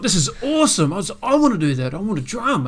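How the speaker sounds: high, thrilled outburst; eager, high-pitched enthusiasm; excited chicken. Acted. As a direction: play an awestruck chicken, pitched right up.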